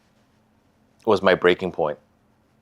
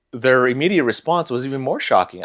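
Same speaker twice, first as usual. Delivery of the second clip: ordinary talk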